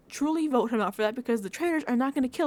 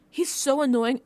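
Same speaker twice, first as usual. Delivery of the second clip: usual speaking voice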